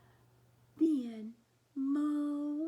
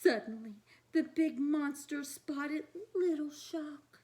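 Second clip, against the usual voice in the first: usual speaking voice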